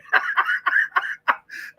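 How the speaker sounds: high, wheezy laughing fit; real laugh. Real, not acted. Speaker after joking about the comeback, high laughter. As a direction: crack up at your own joke.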